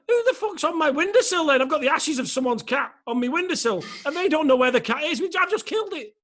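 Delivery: high-pitched, exasperated outburst; indignant panic. Retold or imitated. Cat owner realising whose ashes he has, panicked. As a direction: pitch it right up in comic alarm.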